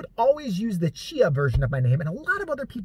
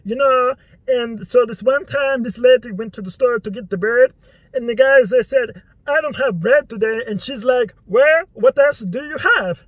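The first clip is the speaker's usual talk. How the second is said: loud, high mock dad voice telling an unfunny joke; imitating dad proudly telling a joke; 6 semitones higher, 7 dB louder than usual. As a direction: sell a pointless joke with full gusto. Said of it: "He might say something like".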